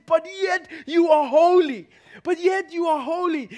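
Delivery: loud, high shout of faith; shouted declaration. Retold or imitated. Preacher voicing Jesus' shout from the cross. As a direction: shout it to heaven.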